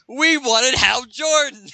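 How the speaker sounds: high, loud, slow whiny complaint; mock upset bankers. Bankers complain they got the wrong Green Lantern, hammed-up high whine. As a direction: whine it like a cheated customer. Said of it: "they're all upset".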